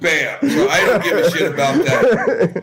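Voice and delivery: a laughing outburst, way up in pitch; laughing shout. Real, not acted. Ranting about not caring.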